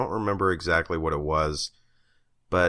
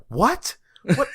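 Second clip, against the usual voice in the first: incredulous what; 4 dB louder than usual. Retold or imitated. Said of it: "I'm like".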